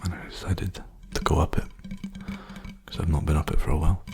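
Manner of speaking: soft, hushed ASMR talk; softly spoken. Real, not acted. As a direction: speak gently, half whispered. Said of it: "I'm gonna keep being softly spoken".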